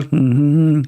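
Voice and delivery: usual speaking voice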